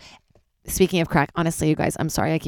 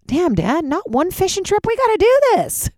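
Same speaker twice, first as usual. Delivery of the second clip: playful protest; 14 semitones higher than usual. Retold or imitated. Teasing her dad about never going fishing, rising playful protest. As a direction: pitch climbing higher and higher, teasing.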